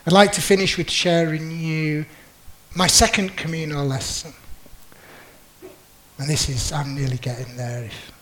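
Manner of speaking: ordinary talk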